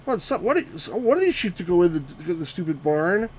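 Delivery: baffled by the sheep; fed-up complaining; exasperated disbelief. Real, not acted. Frustrated at the game.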